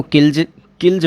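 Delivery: किल्स said as "kilj"; voiced j ending. Shown as a demonstration. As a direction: end किल्स on a buzzed j. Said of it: "बोलेंगे".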